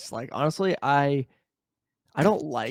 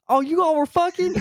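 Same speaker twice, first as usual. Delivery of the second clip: much higher, gossipy mock surprise; nosy bystander; 5 dB louder than usual. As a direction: pop up like a nosy dude. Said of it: "he's like".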